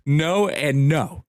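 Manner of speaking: slow, deliberate "No and no", each no spelled out to the cop; slow emphatic denial. Retold or imitated. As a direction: drag out both noes, flatly denying it. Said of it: "I was like".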